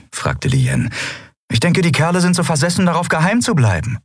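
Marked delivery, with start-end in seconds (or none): 1.5-4.0 high, quick character voice; story character speculating. Acted. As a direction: offer the theory fast and high. Character's theory in acted dialogue. (hohe, schnelle Figurenstimme)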